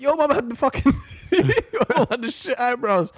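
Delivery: laughing through a yo mama joke; high, laughing mock insult. Real, not acted.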